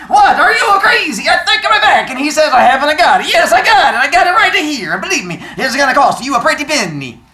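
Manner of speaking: put-on high, loud New York shopkeeper yelling in a thick accent. Old bookseller yelling at the caller, loud and high in a thick accent. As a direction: yell it as the excited old bookseller. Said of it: "Pretty good accent".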